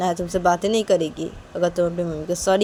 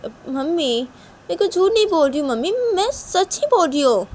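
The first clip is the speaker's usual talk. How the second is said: higher-pitched, earnest daughter voice; protesting young daughter; 10 semitones higher, 7 dB louder than usual. Acted. Shanaya insists to mother she is truthful, pleading. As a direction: plead your honesty to mother.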